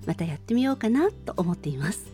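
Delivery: normal talking voice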